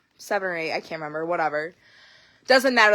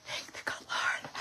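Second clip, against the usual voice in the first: much softer, whispered thanks; whispered relief; 16 dB quieter, slower than usual. Retold or imitated. Thinking relief as her sister takes the blame, whispered. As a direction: breathe it as a secret prayer of relief. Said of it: "And I thought".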